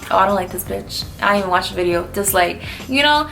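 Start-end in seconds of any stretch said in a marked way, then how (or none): none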